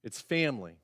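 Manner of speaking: normal talking voice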